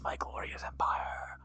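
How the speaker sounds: slow, pained whisper; whispering empress. Acted. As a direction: let the old wound crack through the whisper.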